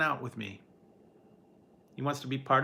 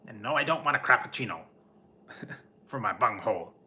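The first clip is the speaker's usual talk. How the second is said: hammed-up Beavis-style grumble; cartoon grump voice; faster than usual. Streamer quoting a cartoon line at chipper coworkers, goofy voice.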